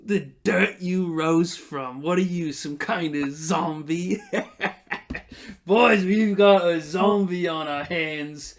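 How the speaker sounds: slow, mocking thug sneering at a risen corpse; lightly voiced tough guy, slow and jeering; gruff NPC taunt. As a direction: drawl it out like a bully with backup.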